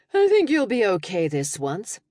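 dry tired brush-off, THINK lifted. Acted. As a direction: shrug it off, rising on think. Tired reply to teasing friend.

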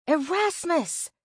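high, slow searching call. A narrator's reading. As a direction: call the name high and drawn out. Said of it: "call out his name, too".

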